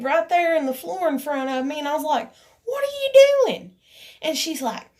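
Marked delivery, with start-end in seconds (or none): 2.6-3.8 high, loud indignant outburst; indignant retort. Retold or imitated. Telling how they confronted her over the flicked cigarette, indignant. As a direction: re-enact an indignant demand, pitched way up. Retelling their challenge in the argument.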